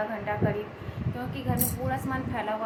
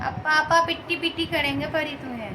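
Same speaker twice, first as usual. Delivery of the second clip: higher, louder sing-song teasing; 5 semitones higher, 5 dB louder than usual. Real, not acted. Mother teasing her baby about papa, playful sing-song. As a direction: tease the little one in a mock-scolding tone.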